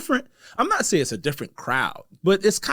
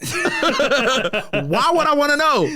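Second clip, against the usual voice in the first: slow, high laughing question; 11 semitones higher, much slower than usual. Real, not acted. Amused host laughing at his own spending. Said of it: "That's rich boy problem".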